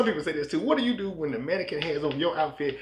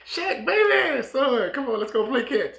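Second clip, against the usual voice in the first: loud, high-pitched eager father; mock fatherly excitement, pitched way up; gushing long-lost dad; 11 semitones higher, 5 dB louder, slower than usual. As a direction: call out to your famous son, high and loud. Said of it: "his real dad was like".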